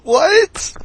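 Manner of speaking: high, loud disbelieving squawk; stunned what. Retold or imitated. Son to his mom, high stunned yelp. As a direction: yelp it in shock. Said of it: "I'm like".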